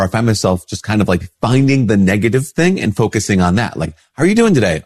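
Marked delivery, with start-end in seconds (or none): 4.1-4.8 a light, higher mock-friendly "how are you"; cheerful greeter. Imitating a friend greeting him, lightly higher and bright. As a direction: play the chipper acquaintance asking brightly.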